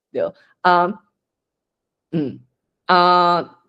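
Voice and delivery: ordinary talk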